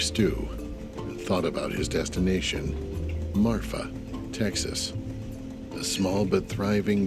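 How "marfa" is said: ordinary talk, nothing marked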